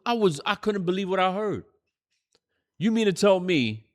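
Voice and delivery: normal talking voice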